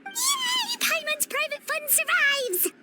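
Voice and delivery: high, bright squeal of delight; excited cheer. Acted. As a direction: cheer as if you just got free stuff. Paimon gets free bottles.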